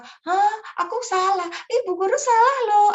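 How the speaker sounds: put-on high, loud childish complaint; mock child protest. Child telling the teacher she got the story wrong, high and loud. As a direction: whine like a kid catching the teacher. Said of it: "teman-teman suka".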